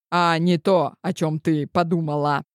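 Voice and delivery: usual speaking voice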